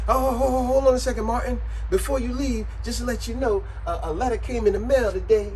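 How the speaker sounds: high, loud singsong warning; Gina stopping Martin. Retold or imitated. Gina stops Martin at the door, sing-song warning. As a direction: call him back sweetly but pointedly. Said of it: "that's when Gina said".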